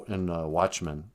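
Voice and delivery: ordinary delivery, nothing marked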